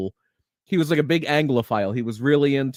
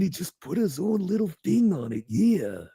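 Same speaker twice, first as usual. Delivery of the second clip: put-on wrestler voice, softer than a shout; Randy Savage; 4 semitones higher, 4 dB quieter than usual. Host shows how a wrestler adapted another's voice, wrestler impression. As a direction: slip into the wrestler's swooping trademark voice. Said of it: "I never heard my brother's real voice again".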